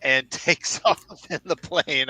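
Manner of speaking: laughing through the words, slowly. Real, not acted. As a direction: chuckle while telling it, slowed down. Laughing at absurd plane scene.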